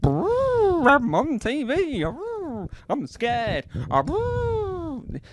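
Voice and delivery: very high, shivering brrr with a quavering voice; high quivering animal voice, brrr sounds; shivering elephant impression. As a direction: tremble like a frightened elephant. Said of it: "This is my impression of an elephant".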